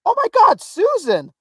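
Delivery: loud, high cry of recognition; instinctive yell. Retold or imitated. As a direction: blurt it out in high-pitched surprise. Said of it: "I just instinctively yelled".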